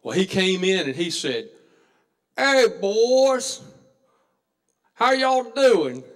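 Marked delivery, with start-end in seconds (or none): 2.4-6.1 put-on loud, slow, higher hail to the boys; cheery greeting. Joe walking into the country store, booming a slow hello. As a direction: call out a big friendly hello.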